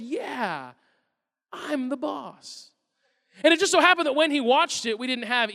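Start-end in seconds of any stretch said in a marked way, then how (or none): 0.0-2.7 put-on slow, soft boastful child; mock toddler voice. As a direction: play a smug little boy, slow. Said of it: "He watched it and he said".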